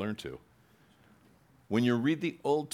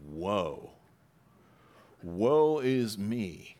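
mournful woe; much slower than usual. Retold or imitated. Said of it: "But he said".

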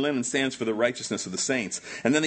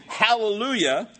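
higher, ringing Hallelujah; shouted praise; 6 semitones higher, 3 dB louder than usual. Acted. Preacher voicing the saints in heaven, shouting praise. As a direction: proclaim it high as praise. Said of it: "I'm doing the shouting right now".

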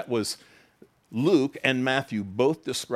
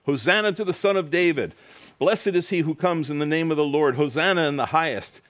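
crowd's cry; 6 semitones higher, 4 dB louder than usual. Retold or imitated. Crowd crying out in story.

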